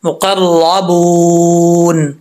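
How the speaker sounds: मुकर्रबून drawn out slowly with a long uu; slow tajweed recitation. Shown as a demonstration. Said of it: "पढ़ेंगे?".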